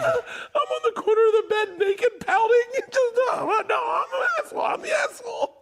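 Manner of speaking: very high, strained pouty wail; hammed-up self-pitying shriek; mock pouting. Acting out a pouting spouse.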